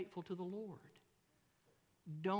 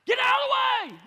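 a much higher, much louder yell; road rage yell; 20 dB louder than usual. Retold or imitated. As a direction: blast it like a driver losing it. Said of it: "I did that voice right there".

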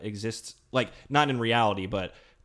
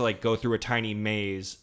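ordinary talk

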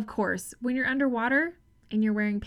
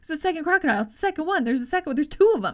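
excited alarm; 5 semitones higher, 5 dB louder, much faster than usual. Retold or imitated. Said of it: "who kept saying it".

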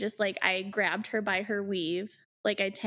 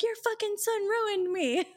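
very high mock shriek; furious retort; 12 semitones higher than usual. Girlfriend lashing out at boyfriend's mom, comically furious. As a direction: screech it in comic outrage.

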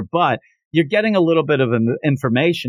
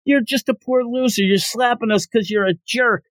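mock yelling; 9 semitones higher than usual. Umps protest the slapping, mock yell. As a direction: imitate outraged yelling in a raised pitch. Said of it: "They start yelling".

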